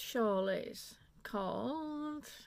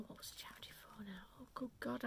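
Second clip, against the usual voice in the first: whispering to herself while trying to remember; whispered aside; 13 dB quieter, faster than usual. Real, not acted. Knitter searches her memory, whispering the question to herself. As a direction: drop to a whisper, thinking aloud.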